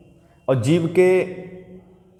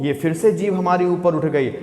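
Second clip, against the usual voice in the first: normal talking voice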